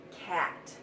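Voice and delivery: ordinary delivery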